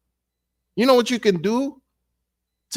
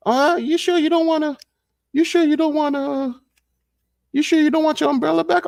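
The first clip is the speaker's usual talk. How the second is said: much higher, a lightly put-on voice begging; mock high pleading voice; 9 semitones higher than usual. Friend at the rain-soaked bus stop, mock high insisting. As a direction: push it up high, hammed-up insistent. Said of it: "You'd be like".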